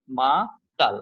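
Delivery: মাতাল in two beats, মা then তাল; correct two-syllable split. Shown as a demonstration. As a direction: break মাতাল only after মা, closing on "tal". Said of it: "মাতালের মধ্যে কয়টা আছে".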